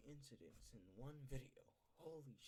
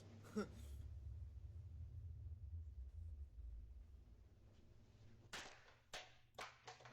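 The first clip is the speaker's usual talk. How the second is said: long breathy laugh; 9 semitones higher than usual. Real, not acted. Reacting to creepy video.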